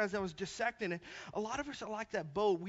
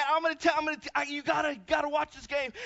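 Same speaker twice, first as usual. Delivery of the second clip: higher, stumbling eager sales pitch; imitated excitement; 6 semitones higher, faster than usual. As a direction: trip over the words, imitating an excited student. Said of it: "so Timmy's all excited".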